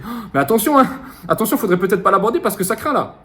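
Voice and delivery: high-pitched, fretful little inner voice; petite voix inquiète. Acted. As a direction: play a worried inner voice warning against it. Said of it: "la petite voix, elle te dit". (petite voix intérieure aiguë et craintive)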